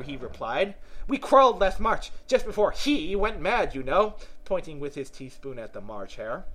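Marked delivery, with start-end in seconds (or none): 1.1-4.2 high, animated Hatter voice; chatty, know-it-all Hatter. Acted. Hatter explaining to Alice how time obliges, eager high voice. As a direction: explain eagerly, pitched well up.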